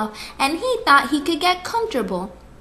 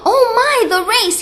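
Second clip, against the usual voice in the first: fast, high, panicked; alarmed realization; 9 semitones higher, 4 dB louder, faster than usual. Acted. Hare realizing he overslept the race, alarmed. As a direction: blurt it out in a rush.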